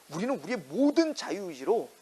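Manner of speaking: ordinary talk, nothing marked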